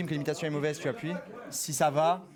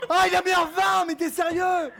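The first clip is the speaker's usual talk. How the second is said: outraged shouting; 9 semitones higher, 6 dB louder than usual. Real, not acted. Outraged at the rating.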